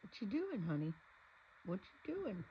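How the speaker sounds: high, loud cooing to the cat. Real, not acted. Talking sweetly to the cat.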